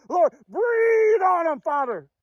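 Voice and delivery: slow, shouted call to the Lord; preaching shout. Real, not acted. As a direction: cry it out like a preacher at full force. Preaching climax, calling on God.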